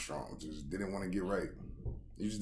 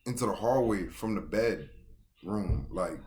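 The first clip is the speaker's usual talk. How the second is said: ordinary delivery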